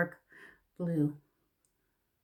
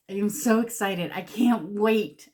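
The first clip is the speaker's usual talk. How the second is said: excited; 2 semitones higher, 6 dB louder than usual. Real, not acted. Vlogger showing off a new palette to viewers, excited. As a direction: gush with real anticipation, louder.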